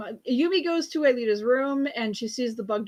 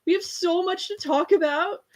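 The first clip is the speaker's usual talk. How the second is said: mock tearful reunion; 8 semitones higher, 3 dB louder than usual. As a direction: lightly play the daughter choking up on the hug. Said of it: "and she cries".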